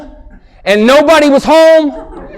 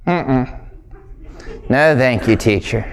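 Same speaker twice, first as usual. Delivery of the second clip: much softer, lower little no; timid refusal; 10 semitones lower, 8 dB quieter than usual. Retold or imitated. Boy politely refusing the teacher, very soft and low.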